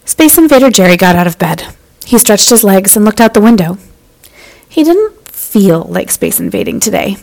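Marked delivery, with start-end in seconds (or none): none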